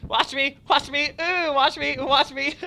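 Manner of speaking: high, loud excited shouts; excited calls. Real, not acted. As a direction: yell for attention, thrilled. Excited showing off in game.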